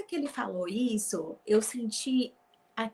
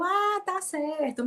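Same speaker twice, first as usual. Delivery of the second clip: higher, slow polite reply; 9 semitones higher, slower than usual. Retold or imitated. Retold reply, not remembering.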